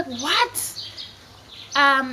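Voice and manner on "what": high rising shocked question; incredulous. Retold or imitated. Children learn it was a prank, incredulous. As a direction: replay the disbelief, pitch leaping up.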